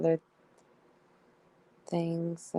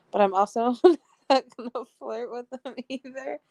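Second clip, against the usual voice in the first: real giggle in a higher, louder voice; laughing protest; 6 semitones higher, 5 dB louder, faster than usual. Real, not acted. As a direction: protest through laughter, pitch lifted. Still giggling at the messages.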